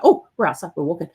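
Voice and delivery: a hint of a dog thrilled by the walk; lightly voiced eager dog. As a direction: playfully voice the dog's delight. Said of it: "she's like".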